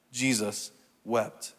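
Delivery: soft and slow, a pause between the words; slow, solemn. Real, not acted. Preacher repeating the shortest verse, slow and solemn. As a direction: set each word down quietly.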